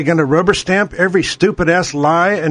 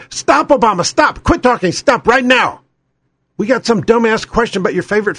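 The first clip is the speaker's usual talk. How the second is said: higher-pitched, insistent mock moderator; Keith Olbermann; 3 semitones higher than usual. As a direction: break up the argument with urgent commands. Mimics moderator cutting off Obama.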